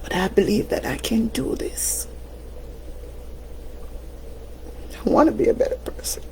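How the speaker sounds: soft whisper, willing herself on; whispered resolve. Real, not acted. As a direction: whisper it as a promise to yourself. Private resolve, almost to herself.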